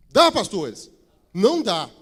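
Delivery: loud challenge to the pastors; booming preacher's question and answer; rhetorical shout. Real, not acted. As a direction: call it out to the room.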